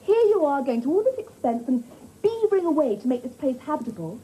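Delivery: normal talking voice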